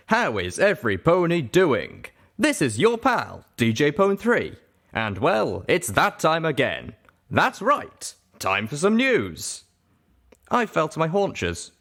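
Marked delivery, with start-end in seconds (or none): 0.1-9.6 radio DJ voice. Acted. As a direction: sell it to the whole wasteland. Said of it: "her voice now male and very familiar".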